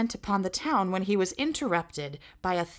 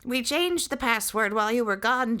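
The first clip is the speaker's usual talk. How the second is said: sentry voice; 5 semitones higher, 4 dB louder than usual. Acted. Sentry high voice.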